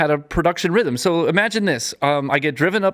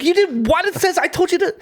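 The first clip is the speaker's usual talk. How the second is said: retold shock and anger, pitched way up; mad outburst. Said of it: "And I got so mad".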